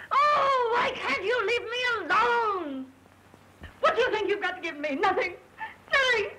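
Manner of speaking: crying out, slow and choked; sobbing breakdown. Acted. Myra breaking down in front of Roy, sobbing. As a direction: let the tears break through every word.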